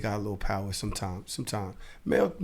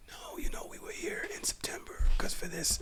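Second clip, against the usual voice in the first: whisper; 5 semitones higher, 10 dB quieter than usual. Retold or imitated. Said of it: "You don't have to whisper".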